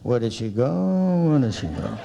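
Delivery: low, dazed mumble; husband muttering to himself. Retold or imitated. Husband alone in hotel lobby, muttering to himself. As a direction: imitate a lost husband grumbling alone. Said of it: "He's talking to himself".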